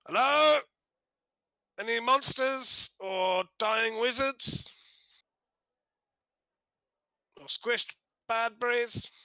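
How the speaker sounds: high, drawn-out shout down a pit; calling down. Acted. Leaning over a drop to hail any monsters below, calling down. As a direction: call into the depths, stretching the words. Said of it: "shout down and say".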